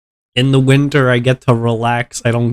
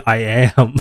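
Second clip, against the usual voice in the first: normal talking voice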